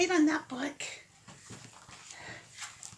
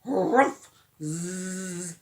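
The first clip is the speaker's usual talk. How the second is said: sleepy dog noises; 4 semitones higher, much slower than usual. Shown as a demonstration. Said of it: "curl up with the sleepy dog".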